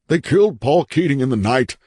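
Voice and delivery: high, urgent outburst; hurried, frantic. A narrator's reading. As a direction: blurt it out in a panic. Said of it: "DeMargin said hurriedly".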